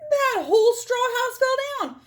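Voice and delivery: high, loud astonished cry; dramatic outburst. Acted. Wolf tells listeners the straw house fell, high and loud. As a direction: burst out with the collapse.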